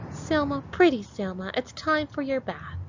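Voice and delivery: put-on high, sweet old granny calling. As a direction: coo like a loving grandmother. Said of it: "Then Granny said".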